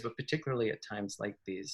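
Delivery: "particularly at times like these" dropped soft and quick; quick quiet aside. Real, not acted. Repeats the phrase as an aside.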